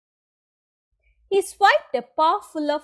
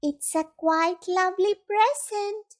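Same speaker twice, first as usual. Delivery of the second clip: gentle high-pitched mouse; 8 semitones higher than usual. A narrator's reading. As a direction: offer it in a small, high voice. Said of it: "Mouse said".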